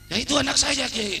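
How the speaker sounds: high-pitched acted reply; protesting visitor. Visitor answers old kiai in skit.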